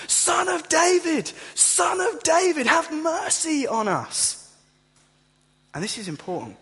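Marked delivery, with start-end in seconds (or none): 0.1-4.5 much higher, louder, slow desperate cry. Retold or imitated. Blind men crying out over the crowd's shushing, desperate. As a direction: cry out even harder for mercy. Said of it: "they cry out all the more".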